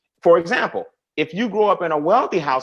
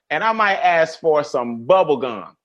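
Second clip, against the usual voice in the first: ordinary delivery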